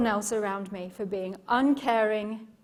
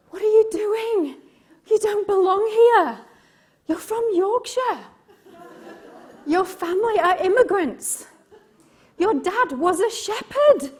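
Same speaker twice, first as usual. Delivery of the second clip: high, loud, taunting little voice in her head; inner critic voice; 10 semitones higher, 7 dB louder than usual. Retold or imitated. Her inner critic taunting her, high and loud. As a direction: let the nagging inner critic jeer at you. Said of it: "this little voice inside of my head saying".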